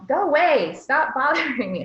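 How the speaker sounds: put-on high, loud, impatient snap at a toddler; mock harsh brush-off. A parent brushes off a needy toddler, loud and sharp. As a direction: shoo the child away, sharply. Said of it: "and say".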